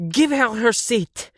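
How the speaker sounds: put-on high, loud, slow heavy-accent command; furious demand. A narrator's reading. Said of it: "Siv said, so mad that she started to speak with a heavy accent".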